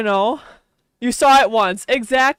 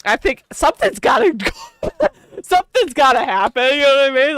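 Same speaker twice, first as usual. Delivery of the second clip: laughing; 4 semitones higher, 5 dB louder than usual. Real, not acted. Laughing about politics.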